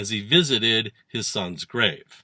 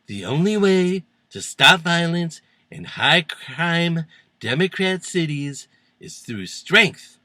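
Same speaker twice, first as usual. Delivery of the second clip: slow, higher mock speechifying; mock declaiming; 5 semitones higher, 3 dB louder than usual. Host quoting a tough-on-crime claim, mock declaiming. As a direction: declaim it slowly, spacing out the phrases.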